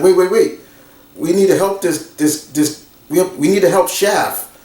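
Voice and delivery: a mock frantic plea to help; urgent Cordelia. Cordelia stops the escape urgently.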